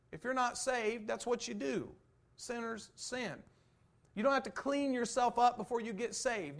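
loud, high pulpit preaching; fiery preacher pressing the congregation; preaching at full force. Acted. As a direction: drive the text home to the church. Preaching continues.

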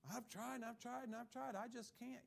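lightly mocking helpless voice; defeated excuse. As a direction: play the quitter who gave up. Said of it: "Yes, you can".

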